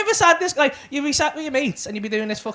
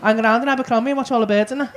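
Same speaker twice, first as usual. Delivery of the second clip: normal talking voice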